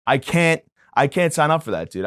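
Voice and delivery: ordinary talk, nothing marked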